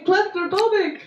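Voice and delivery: put-on high, whining baby voice; whiny man child. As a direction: whimper it like a big baby. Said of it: "Whining like a little baby".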